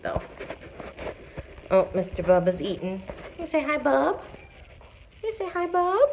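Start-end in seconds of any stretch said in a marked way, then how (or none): 3.4-4.2 pet talk. Real, not acted. Greeting cat in pet voice. 5.2-6.0 pet talk. Real, not acted. Greeting cat in pet voice.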